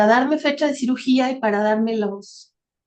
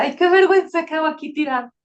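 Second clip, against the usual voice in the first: higher-pitched mortified lament; retold embarrassment; 5 semitones higher, 4 dB louder, faster than usual. Shy woman lying on the church floor to herself, embarrassed. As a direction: replay your own embarrassed inner wail. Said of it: "soy bien tímida, bien penosa".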